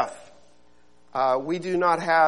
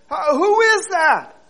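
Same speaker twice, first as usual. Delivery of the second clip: high, slow, frightened asking; anxious question; 11 semitones higher, 7 dB louder than usual. Acted. Man on the branch asking who answered, frightened. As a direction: call up, unsure who answered. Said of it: "the man said".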